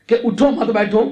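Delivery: ordinary talk, nothing marked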